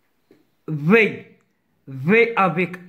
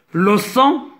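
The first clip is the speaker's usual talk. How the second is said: ordinary talk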